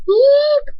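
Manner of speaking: a sharp, very high electronic beep; shrill beep. Acted. The narrator voicing a beep in the scene, sharp and high. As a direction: squeal it out like a machine. Sound effect in the story.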